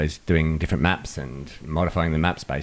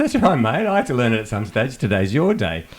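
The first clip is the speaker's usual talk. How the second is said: cheerful encouragement; 6 semitones higher than usual. Retold or imitated. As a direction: pump the caller up. Cheering the caller.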